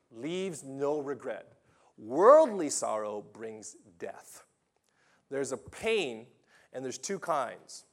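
fiery preacher contrasting heaven and hell; preaching at full force. Acted. Preacher hammers two kinds of sorrow.

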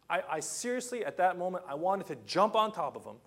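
ordinary talk, nothing marked

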